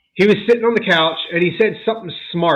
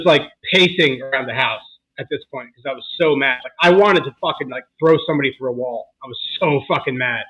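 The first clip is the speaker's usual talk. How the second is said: normal talking voice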